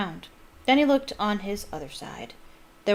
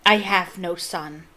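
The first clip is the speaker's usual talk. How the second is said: cold disowning father; 6 dB louder, slower than usual. Acted. Crouch disowning son.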